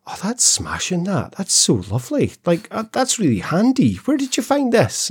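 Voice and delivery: high gushing Glasgow shop lady; chatty shop assistant. Retold or imitated. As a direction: gush like a delighted shop assistant. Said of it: "the woman was saying".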